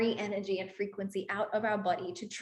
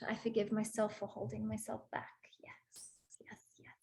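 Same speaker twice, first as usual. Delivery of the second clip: whispered affirmation; 8 dB quieter than usual. Real, not acted.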